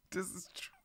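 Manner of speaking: breathy teasing whisper. Acted. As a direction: whisper it like a secret. Teasing whisper then repeat.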